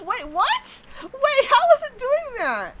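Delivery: high, loud, slow incredulous cry; can't believe the water is doing this; baffled disbelief. Real, not acted.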